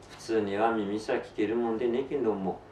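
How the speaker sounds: plain reading voice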